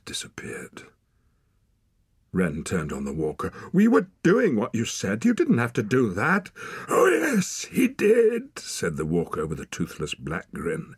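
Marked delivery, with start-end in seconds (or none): 3.7-6.5 high, loud, indignant objection; angry protest. A narrator's reading. Girl turns on man, protesting. 6.9-8.6 loud, very high gloat; wild man gloating. A narrator's reading. Wild man's gloating, menacing retort.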